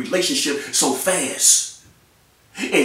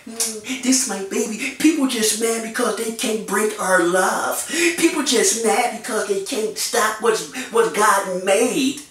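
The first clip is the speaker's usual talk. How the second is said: mocking the couple; 6 semitones higher than usual. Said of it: "y'all be like".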